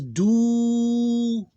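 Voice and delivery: duru with long high du, then short ru; two open syllables. Shown as a demonstration. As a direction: hold the first u of duru very long. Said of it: "two open syllables".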